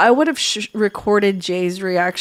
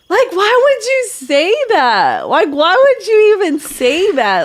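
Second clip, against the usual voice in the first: indignant; 9 semitones higher, 4 dB louder than usual. Real, not acted. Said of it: "indignant retort".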